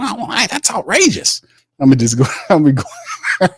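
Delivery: indignant high outburst. Retold or imitated. Mock argument, Mickey protesting.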